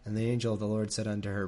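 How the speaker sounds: ordinary delivery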